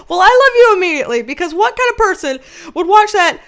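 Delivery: real amused astonishment; incredulous gushing. Real, not acted. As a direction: gush loudly in amused disbelief. Incredulous reply to flattering viewer.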